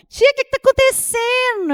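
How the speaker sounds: a light niece voice asking what's wrong; voicing niece. Niece voiced in retold story.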